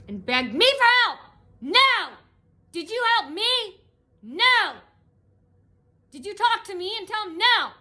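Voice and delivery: loud, high, outraged questions; firing back at her with no and no; indignant rant. Real, not acted. Ranting about the lady, loud and outraged.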